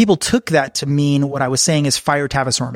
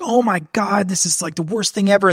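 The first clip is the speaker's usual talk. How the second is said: higher pitched, imitating a panicking coworker; mock panic; 6 semitones higher than usual. Coworker rushing to the desk, in a mock panic. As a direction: play the coworker freaking out, tongue in cheek. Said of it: "they'd be freaking out".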